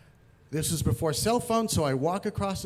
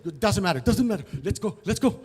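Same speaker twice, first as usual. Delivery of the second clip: higher, insistent hurrying; hurried urging; 4 semitones higher, 4 dB louder than usual. Retold or imitated. Driver rushes the man locked out, hurried urging. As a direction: brush it off and urge them to move.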